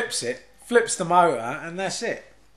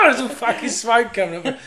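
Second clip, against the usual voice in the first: normal talking voice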